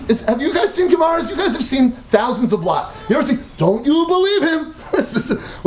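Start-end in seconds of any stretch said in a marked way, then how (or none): 3.6-4.7 "Don't you believe him?" rising at the end on "believe", a laugh in it; incredulous question. Shown as a demonstration. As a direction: ask it as an amused, disbelieving question. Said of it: "this is the way we talk".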